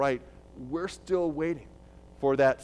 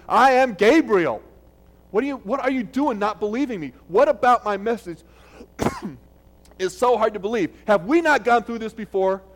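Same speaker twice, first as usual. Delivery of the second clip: loud and higher, a scolding angel; 4 semitones higher, 7 dB louder than usual. Acted. Gabriel rebuking doubting Zechariah in the temple, loud and scolding. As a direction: thunder it like a messenger rebuking doubt. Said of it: "He had to say this in a loud voice".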